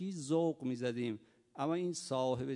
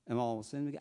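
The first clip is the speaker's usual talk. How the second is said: usual speaking voice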